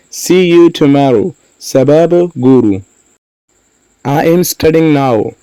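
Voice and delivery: usual speaking voice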